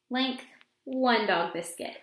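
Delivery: ordinary delivery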